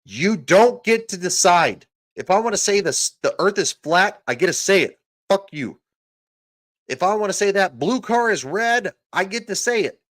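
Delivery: high, loud yelling rant. Real, not acted. Ranting to viewers about free speech, yelling. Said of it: "I'm yelling".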